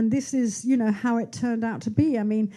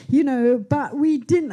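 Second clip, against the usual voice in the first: usual speaking voice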